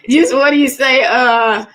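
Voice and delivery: chuckle, then a laughing question; amused laugh greeting a viewer. Real, not acted. Laughs reading a viewer's name.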